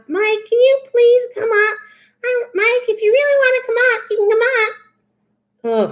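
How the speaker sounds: high whiny begging voice; high pitched mock plea; mock pleading. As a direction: whine it like a begging child. Mimics Mike begging again.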